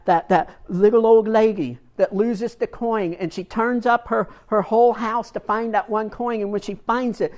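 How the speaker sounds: ordinary talk, nothing marked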